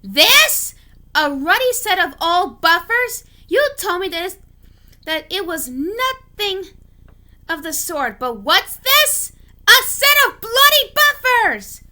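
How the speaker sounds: high, loud indignant yelling; furious demand. Acted. James furious at Thomas for the old buffers, loud high ranting. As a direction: spit out the outrage at the buffers. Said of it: "demanded James".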